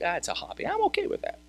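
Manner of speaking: light dismissive voice rising high on okay; mock non-believer. Pastor mocking a non-believer, dismissive and high. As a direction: brush it off like a friend who shrugs at faith. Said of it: "'cause that's how they view it".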